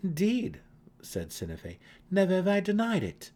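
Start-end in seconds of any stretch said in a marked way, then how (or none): 0.0-0.6 high, loud, proud admission; defiant confirmation. A narrator's reading. Defiant reply to the accusation. 2.1-3.3 high, loud, proud declaration; defiant. A narrator's reading. Accused matron answers boldly.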